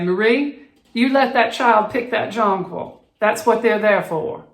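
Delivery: put-on elderly Southern lady calling from her porch in a refined Southern accent. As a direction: lift into a genteel Southern accent with dropped R's. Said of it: "wonderfully refined, and elevated Southern accent".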